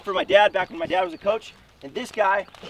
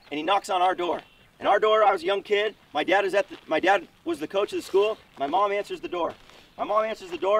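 plain reading voice

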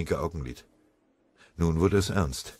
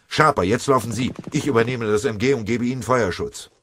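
high, loud military order; barked command; 6 semitones higher, 6 dB louder than usual. A narrator's reading. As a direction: shout the order to run. Sergeant ordering gunner to run.

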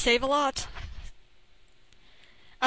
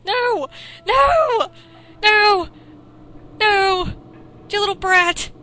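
loud, higher furious shouts of no; angry yells; 6 semitones higher, 7 dB louder, slower than usual. Real, not acted. Player yells at the boy who stole the bear, furious shout. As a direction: scream at the thief.

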